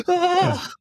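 high-pitched mock shriek; imitated baby's wail. Dad re-enacting his baby startled by the game, imitated wail. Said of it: "And he just starts wailing".

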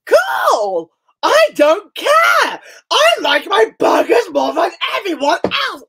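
mock sobbing yell at the dog over burgers; mock crying yell. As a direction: wail it like a fake tantrum. Hammed tantrum wailing again.

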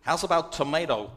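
"tomato" said to-MAY-to, American style; American pronunciation. Retold or imitated. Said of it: "So I give in and I say".

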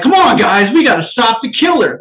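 Chris Rock. Retold or imitated. Mimicking the Spiral trailer for the co-host, comedian impression. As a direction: punch it out loud with big pitch swoops. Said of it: "That's my Chris Rock impression".

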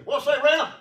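loud, high call to a buddy; hollered greeting. Shown as a demonstration. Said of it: "I always say".

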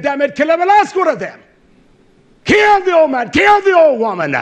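very high, loud preacher's shout; shouted command. Acted. Preacher voicing God's command against the old, shouted command. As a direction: bellow the order at full pitch.

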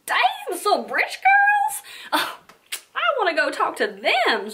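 very high, loud mock-excited onlookers; exaggerated imitation of awed admirers; impressed partygoers. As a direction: squeal it as smitten partygoers, stretched out. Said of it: "And they're like".

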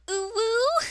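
put-on high squeaky anime girl "ooh woo". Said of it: "I have to go".

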